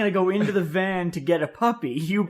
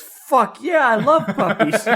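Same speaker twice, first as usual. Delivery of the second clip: higher, laughing, eager mock sister; 6 semitones higher, 6 dB louder, slower than usual. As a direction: gush it like a carefree kid sister. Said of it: "She goes".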